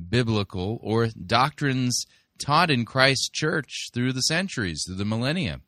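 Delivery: normal talking voice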